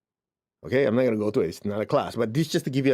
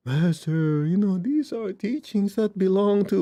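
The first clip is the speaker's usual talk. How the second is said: slow, higher, dismissive parody of the lecture; mocking the disciple; 6 semitones higher, slower than usual. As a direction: lightly mimic a preachy guy, trailing off. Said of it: "He said".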